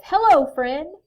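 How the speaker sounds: high, loud storybook hello; friendly greeting. A narrator's reading. Bear to Owl, hearty greeting.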